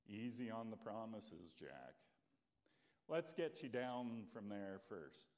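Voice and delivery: God reassuring Jack on the cliff, calm and low. Acted. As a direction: speak from above, serene. God speaks calmly to Jack.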